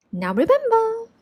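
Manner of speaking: fairy godmother lifts her voice to warn. A narrator's reading.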